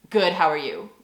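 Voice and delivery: low, rapid rote response; quick automatic reply. Shown as a demonstration. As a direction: rattle it off without stopping to think. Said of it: "Like, very quickly".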